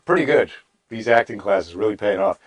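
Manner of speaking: usual speaking voice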